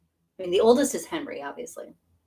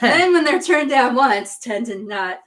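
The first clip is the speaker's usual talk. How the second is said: laughing; 6 semitones higher, 8 dB louder than usual. Real, not acted. Laughing about Mister Collins.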